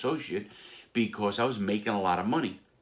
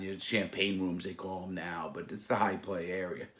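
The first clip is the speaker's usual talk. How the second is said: usual speaking voice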